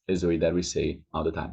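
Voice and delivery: normal talking voice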